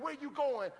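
a hammed-up jealous demand; playful mock of a suspicious girlfriend; mock nagging woman.

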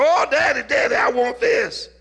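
put-on excited child's shout, high and loud; high-pitched pleading to Daddy; little Fred. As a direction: shout it like a small boy begging his father. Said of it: "little Fred was shouting".

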